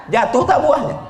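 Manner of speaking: loud, high laughing question; laughing shout. Real, not acted. Preacher joking with the women about shaking a date palm, laughing loud. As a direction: toss the question out with a burst of laughter.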